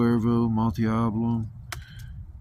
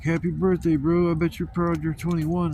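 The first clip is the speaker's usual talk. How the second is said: mock friend cheer; 7 semitones higher than usual. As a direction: imitate the buddy hyping him up.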